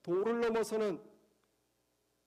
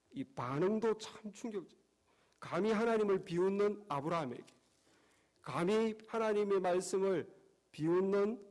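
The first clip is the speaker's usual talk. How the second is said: usual speaking voice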